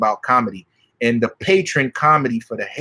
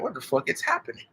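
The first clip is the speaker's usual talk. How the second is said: inner disbelief; 4 semitones higher, 10 dB quieter, faster than usual. Retold or imitated. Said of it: "thinking to my mind".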